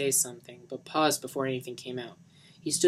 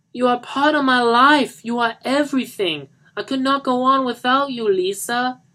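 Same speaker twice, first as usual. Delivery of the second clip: anguished declaration; 11 semitones higher, 11 dB louder than usual. Acted. Johnny declaring Lisa is his everything, anguished loud plea. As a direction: pour it all out to her.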